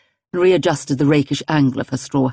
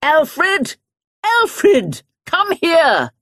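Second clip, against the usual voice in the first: much higher, loud calling yell; 13 semitones higher, 3 dB louder, much slower than usual. Acted. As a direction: call him across the garden. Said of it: "and at the same time yelled".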